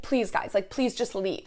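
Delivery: normal talking voice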